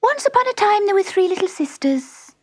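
very high little mouse voice; hurried Dormouse. A narrator's reading. The woken Dormouse starting a story, hurried squeak. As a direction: start the tale in a squeaky rush. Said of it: "began in a great hurry".

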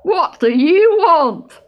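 loud, slow demand from a woken animal; gruff Otter voice. A narrator's reading. Woken Otter answers the stoat, in a loud, slow character voice. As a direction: draw it out loudly, as a disturbed otter. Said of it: "asked a deep voice".

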